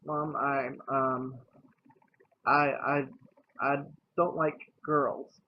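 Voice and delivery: stammered, halting confession with false starts; stuttering. Retold or imitated. Coming out to mom over the phone, stuttering. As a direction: stutter on "I" before getting it out. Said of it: "and I was stuttering".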